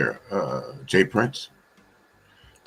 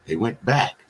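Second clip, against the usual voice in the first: normal talking voice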